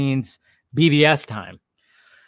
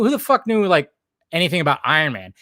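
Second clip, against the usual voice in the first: normal talking voice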